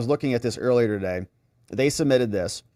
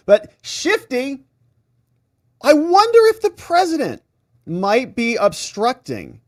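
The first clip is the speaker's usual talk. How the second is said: sarcastic mock wonder; 10 semitones higher, 5 dB louder than usual. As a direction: drag it out, dripping with sarcasm. Mocking Schiff's question.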